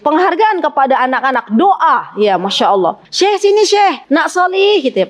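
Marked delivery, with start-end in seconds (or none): 3.1-4.8 calling a pupil over, pitched higher and affectionate; fond calling. Shown as a demonstration. Ustazah calling a pupil with a kind name, fond. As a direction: beckon the child warmly by an honorific. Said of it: "Panggil dengan panggilan-panggilan yang baik".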